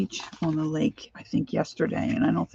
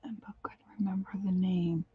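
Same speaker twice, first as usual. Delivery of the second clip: whispered aside; 9 dB quieter, faster than usual. Real, not acted. Aside while crafting.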